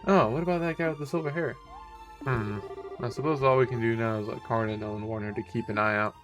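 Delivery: normal talking voice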